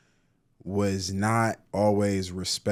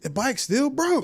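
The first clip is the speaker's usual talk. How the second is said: way up high, loud and exasperated; exasperated squeal; 5 dB louder than usual. Real, not acted. To a friend about complainers, high exasperated squeal. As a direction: throw your hands up in a squeaky disbelief.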